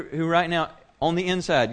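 usual speaking voice

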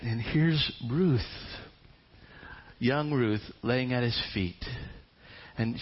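usual speaking voice

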